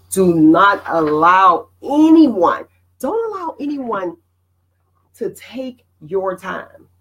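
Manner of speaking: drawn out, each word weighted; slow it down and press every word; slow emphatic plea. Real, not acted. Host urges viewers to guard their time, slow and emphatic.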